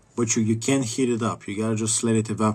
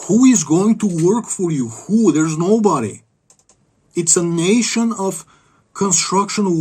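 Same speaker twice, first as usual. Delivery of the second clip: ranting; 6 semitones higher, 6 dB louder than usual. Real, not acted. Ranting about his country.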